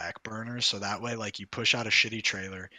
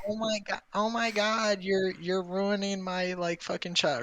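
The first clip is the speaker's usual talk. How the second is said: complaining fans; 10 semitones higher, 3 dB louder than usual. Retold or imitated. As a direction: imitate fans wailing in protest. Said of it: "People would be like".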